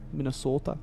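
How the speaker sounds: "Minnesota" with a long, pure Midwestern O; Minnesota accent. Shown as a demonstration. Streamer listing American accents for viewers, mock Minnesota accent.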